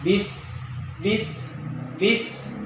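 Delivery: bis with the s breathed out; hissing sin. Shown as a demonstration. Teacher repeating bis for students. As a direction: let the s of bis flow with air. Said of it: "harus biasa".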